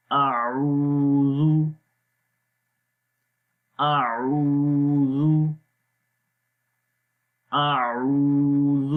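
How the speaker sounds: "اعوذ" chanted slowly with a soft ending ذ; slow recitation of a'udhu; soft zaal recited. Shown as a demonstration. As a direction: recite "اعوذ" long and gentle. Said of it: "نرم پڑھیں گے".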